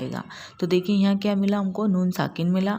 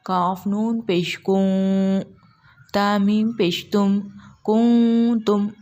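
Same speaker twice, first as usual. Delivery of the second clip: slow, drawn-out tajweed spelling; slow Quran recitation; much slower than usual. Shown as a demonstration. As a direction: recite each letter slowly with the ikhfa. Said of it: "नून की आवाज़ को हमको छुपाना है".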